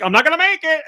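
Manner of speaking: high, loud mock panic cry. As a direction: yelp it like you're about to fall. Panicked self-quote mid paraglide.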